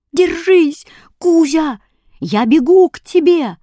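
high, loud rallying cry; loud, high promise of rescue; urgent calling out. A narrator's reading. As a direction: shout encouragement across a distance. Hero calling to freezing cat.

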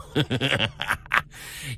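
sinister rising giggle; creepy giggle. Acted. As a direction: giggle like a maniac. Maniac laugh.

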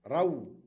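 رو called out loud; loud example word. Shown as a demonstration. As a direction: punch out رو. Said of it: "للجمع". (نطق رو بصوت عال)

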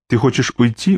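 usual speaking voice

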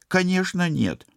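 high, loud indignant denial; indignant protest. A narrator's reading. Polina rejects Dan's comparison to Michel, indignant.

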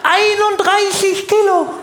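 very high, slow incredulous cry; shocked exclamation. Acted. Weighing the thin girlfriend on the scale, shocked high cry.

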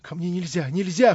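high-pitched alarmed protest; frightened cry. A narrator's reading. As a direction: rise from alarm into a loud cry. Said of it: "вскричала она ещё в сильнейшем испуге".

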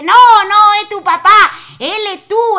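a shopkeeper correcting a confused customer, loud; emphatic correction. Acted. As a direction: insist loudly it's only him. Shopkeeper corrects villager loudly.

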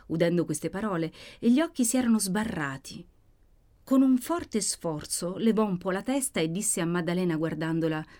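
ordinary delivery

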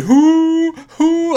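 hooting. Retold or imitated.

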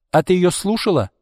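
high-pitched indignant question; acted exasperated challenge. A narrator's reading. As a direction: scold your friend for listening. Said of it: "возмутилась Марина".

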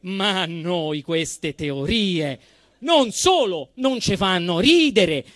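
ordinary delivery

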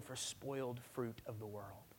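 whispered, soft and slow. Acted. Pastor whispering to the congregation about God's grief. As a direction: let it fall to a hushed whisper. Sermon drops to a hush.

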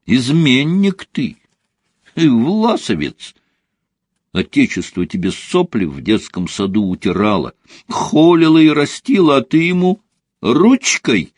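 high, hammed-up accusation; mock indignation. Mitya berates his friend over leaving for Israel, mock indignation. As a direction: denounce a friend with theatrical reproach.

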